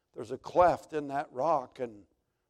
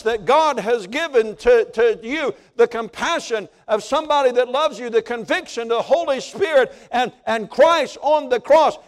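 much louder and higher pleading cry; preaching at full force; 11 semitones higher, 17 dB louder than usual. Real, not acted. Preacher begging the congregation this morning, at full force. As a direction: beg them at the top of your voice.

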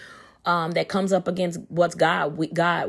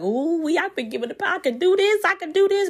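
excited; 12 semitones higher than usual. Retold or imitated. Preacher reliving her thrill at reading the verse, giddy high cry. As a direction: squeal it like you just found your power. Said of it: "I was just so excited".

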